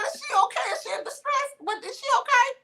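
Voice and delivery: anxious granny checking on her; worried grandmother. Retold or imitated. Spirit grandmother worried about her grandchild, high fretful voice.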